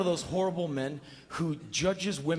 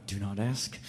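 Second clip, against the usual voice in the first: softer, lower inner warning; lightly voiced self-talk; 4 semitones lower, 6 dB quieter than usual. Talking to himself while driving on a date, softer. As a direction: coach yourself under your breath. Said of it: "I'm driving, I go".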